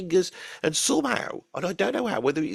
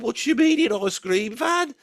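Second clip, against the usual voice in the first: indignant disbelief; 7 semitones higher, 3 dB louder than usual. Retold or imitated. Indignant customer in retold argument.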